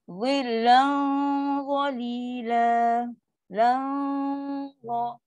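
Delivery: "Lan" held on a heavy hum before the next letter; slow "Zillan qalila" with thick drawn-out nasal; thick tafkhim dengung. Shown as a demonstration. Teacher demonstrates the thick type of hum, tafkhim dengung. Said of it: "dengung dia pula jenis tafkhim tebal".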